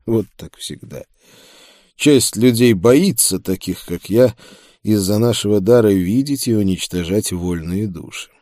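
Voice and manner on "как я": ordinary delivery, nothing marked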